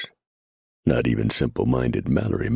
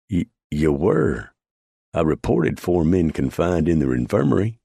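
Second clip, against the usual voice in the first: nervous stammer; 5 semitones higher, 3 dB louder than usual. A narrator's reading. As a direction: stammer out the excuse. Frightened quartermaster stammers.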